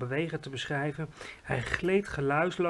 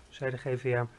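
ordinary delivery

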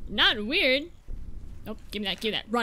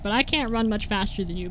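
ordinary talk

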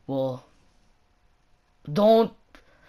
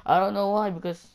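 normal talking voice

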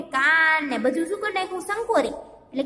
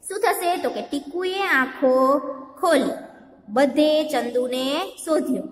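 usual speaking voice

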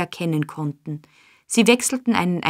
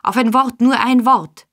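higher, louder begging; pleading cry; 5 semitones higher, 6 dB louder than usual. A narrator's reading. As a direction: beg him to stop and listen.

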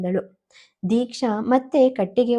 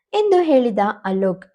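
plain reading voice

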